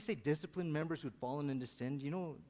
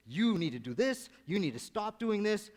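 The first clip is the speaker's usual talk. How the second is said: firm, louder coach telling them off; stern coach voice; 7 semitones higher, 5 dB louder than usual. Retold or imitated. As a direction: bark orders at the team at halftime. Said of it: "I said it in a voice that".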